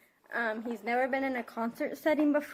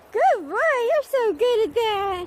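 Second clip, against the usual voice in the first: high, loud, slow praise for the dog; sing-song dog praise; 11 semitones higher, 11 dB louder, much slower than usual. Real, not acted. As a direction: stretch it out like praising a puppy.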